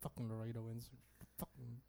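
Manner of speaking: muttered anger. Retold or imitated. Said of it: "My anger just".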